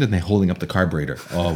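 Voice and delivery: usual speaking voice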